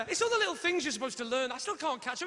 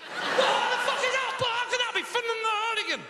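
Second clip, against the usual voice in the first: high, loud comic disbelief; exasperated outburst; 11 semitones higher, 4 dB louder than usual. Acted. Comedian reacting to a spelling, exasperated outburst. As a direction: wail it, utterly baffled.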